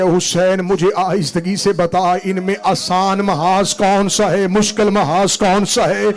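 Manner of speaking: impassioned majlis cry building to a sung wail; full-force preaching. Acted. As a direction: lament at full force for the mourners.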